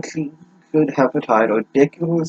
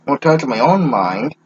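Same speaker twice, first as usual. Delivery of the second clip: ordinary talk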